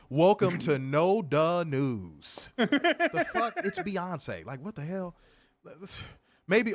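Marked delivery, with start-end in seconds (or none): 0.1-2.5 slow, louder anchorman intro; mock news anchor. 2.6-4.4 high, laughing "the fuck"; laughing disbelief. Real, not acted.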